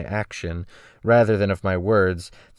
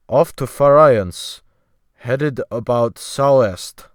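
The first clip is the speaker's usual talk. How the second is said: put-on slow, methodical sailor with a Scandinavian accent; slow Scandinavian English; 3 semitones higher, 6 dB louder, slower than usual. The sailor answering the newcomer, slow with a Scandinavian accent. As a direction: grope for each English word slowly. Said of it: "he answered slowly and methodically".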